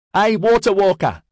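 put-on high, loud kid's shout; young boy calling cheekily to the hero; cheeky yell. A narrator's reading. Shouted high and cheeky from the crowd. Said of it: "a young boy yelled out cheekily".

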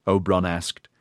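plain reading voice

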